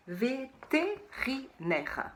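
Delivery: vétérinaire drawn out slowly, syllable by syllable. Shown as a demonstration. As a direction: spell out vétérinaire slowly for children. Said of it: "celui-là, il est dur". (vétérinaire détaché très lentement)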